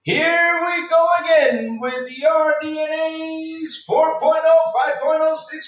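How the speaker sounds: high, slow, drawn-out hype call; hyped sing-song. Pretend. Streamer hypes chat about new graphics cards. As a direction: belt it out like a ring announcer.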